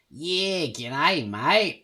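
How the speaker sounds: put-on broad Aussie accent, high swooping pitch; Australian accent. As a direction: lay on a thick, unsexy Australian twang. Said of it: "the Australian accent can be really unsexy".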